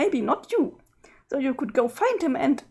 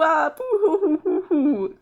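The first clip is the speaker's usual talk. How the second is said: mock boo-hoo sobbing; slower than usual. Sprite lamenting her friends are gone.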